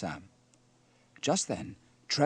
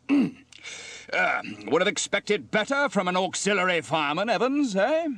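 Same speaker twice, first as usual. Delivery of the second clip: high, loud officer's telling-off; reproachful station officer; 9 semitones higher, 8 dB louder than usual. Acted. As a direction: chide a subordinate loudly, voice lifted high. Steele scolds fireman Evans after mishap.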